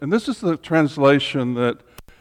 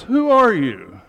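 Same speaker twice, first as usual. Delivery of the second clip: a cheeky, challenging question, said higher and louder; rude question; 6 semitones higher, 6 dB louder, slower than usual. Retold or imitated. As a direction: toss it at God like an impudent upstart. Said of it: "a rude question in a way to ask God".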